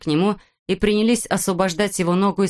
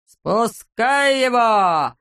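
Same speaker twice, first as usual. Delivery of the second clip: higher, drawn-out yell; shouted order; 4 semitones higher, much slower than usual. A narrator's reading. Klops ordering the dog released, shouting. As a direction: stretch the command into a long cry. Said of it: "закричал Клопс".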